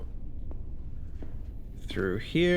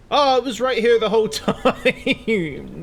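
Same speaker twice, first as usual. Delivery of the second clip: loud laugh breaking into words; 7 semitones higher, 7 dB louder than usual. Real, not acted. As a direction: laugh at finding it right there. Finds item, laughs.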